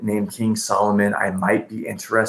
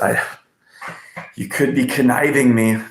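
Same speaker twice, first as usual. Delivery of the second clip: ordinary talk, nothing marked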